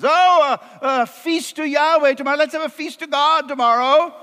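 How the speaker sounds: high, hesitant improvising; stammering acted panic, pitched high; flustered Aaron. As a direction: stumble in high-pitched, then cover with a hasty suggestion. Aaron stalls the unleashed mob.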